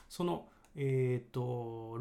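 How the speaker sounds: ordinary delivery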